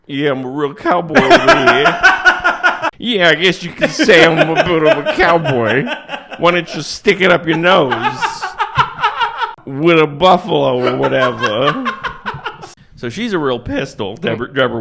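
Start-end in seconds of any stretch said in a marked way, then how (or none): 0.1-11.9 laughing mock cowboy. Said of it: "And he's like".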